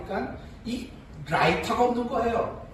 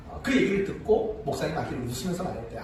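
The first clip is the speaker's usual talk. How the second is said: normal talking voice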